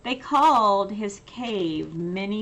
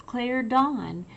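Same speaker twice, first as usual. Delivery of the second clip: ordinary delivery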